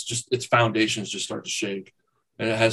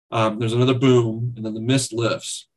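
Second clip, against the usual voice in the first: ordinary delivery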